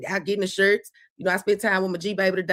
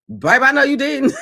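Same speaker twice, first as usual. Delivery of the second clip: high, loud, laughing retort; playfully incredulous, breaking into laughter; mock-shocked and laughing; laughing mock disbelief; 6 semitones higher, 8 dB louder than usual. Mock scolding the grandchild, laughing.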